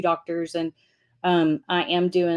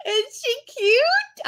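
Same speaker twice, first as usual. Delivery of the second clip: high, slow and sweet, gushing over a photo; drawn-out high cooing; cutesy high voice; much slower than usual. Real, not acted. Showing photo of her twin.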